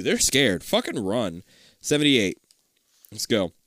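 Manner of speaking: usual speaking voice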